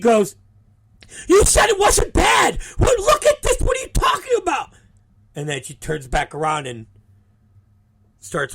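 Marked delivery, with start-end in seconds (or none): 1.3-4.7 imitated high, loud, panicked accusation; outraged screaming. Injured wrestler runs back to accuse the promoter, outraged screaming.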